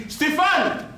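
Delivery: shouted name, high; calling out. Retold or imitated. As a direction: call across the room. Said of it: "Quand je crie".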